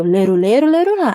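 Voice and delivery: the reader hears it as snippy; read in a harsher tone. Retold or imitated. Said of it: "Aí a pessoa leu".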